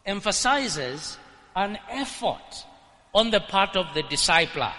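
ordinary talk

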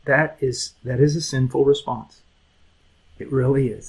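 ordinary talk

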